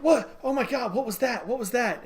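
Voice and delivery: a lightly voiced Wonder Woman jolted awake; startled Wonder Woman.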